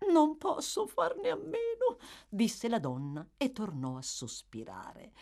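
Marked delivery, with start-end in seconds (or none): 0.1-2.0 very high, plaintive lady; helpless matron. A narrator's reading. Mrs Corney excusing her sighs to Bumble, plaintive. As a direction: plead helplessness in a high feminine voice.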